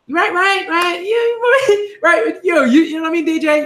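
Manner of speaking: squeaky high giggling through the words; giddy, can't stop laughing; high laughing burst; giggly high laughing. Real, not acted. Giddy laughing outburst to viewer.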